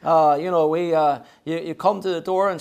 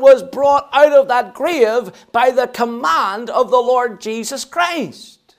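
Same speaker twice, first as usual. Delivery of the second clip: higher, ringing pulpit delivery; impassioned preaching; 7 semitones higher, 5 dB louder than usual. Real, not acted. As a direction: proclaim it with mounting conviction. Preaches at full force.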